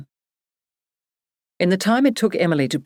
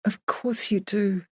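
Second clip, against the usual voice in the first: softer and slower, soothing; quietly; 4 semitones higher, 5 dB quieter, slower than usual. Acted. Emily comforting her sobbing friend, quiet and slow. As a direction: hush it gently to comfort her. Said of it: "Emily said quietly".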